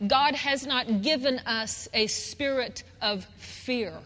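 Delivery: ordinary talk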